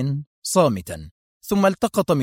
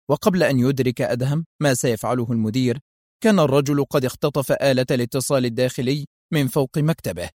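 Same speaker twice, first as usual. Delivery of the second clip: ordinary delivery, nothing marked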